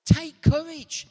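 loud, bold take courage; bold command. Shown as a demonstration. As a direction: call them to be bold. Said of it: "It means be bold".

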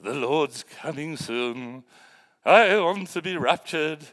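lightly mock the weary old saints; mocking older churchgoers. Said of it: "they go".

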